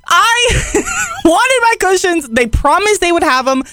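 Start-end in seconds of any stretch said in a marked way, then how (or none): 0.1-2.3 high, whiny mock cry with a laugh breaking in; mock meltdown wail. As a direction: wail it like a grown-up tantrum, half laughing. Retold cushion meltdown at store.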